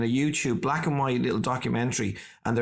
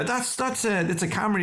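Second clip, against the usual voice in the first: high, patient explaining voice; explaining interviewers; 9 semitones higher than usual. Retold or imitated. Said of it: "they're going".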